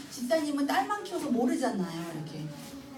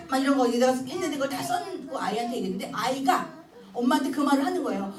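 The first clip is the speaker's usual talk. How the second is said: ordinary talk, nothing marked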